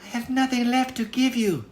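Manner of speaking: a small despairing plea; sad girl. Acted. Girl tells the little man she has nothing, sad and high. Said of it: "the girl said sadly".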